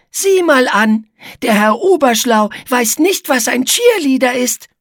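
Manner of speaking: high, loud, teasing squeak; amused taunt in a high character voice; Igitte, mocking spider. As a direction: needle the know-it-all in a squeaky voice.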